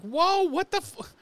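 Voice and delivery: high, stunned squeak of disbelief; high-pitched outraged whoa; shocked disbelief. Retold or imitated. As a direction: squeal it out in mock shock, then land on hell. Shocked reaction to his insult.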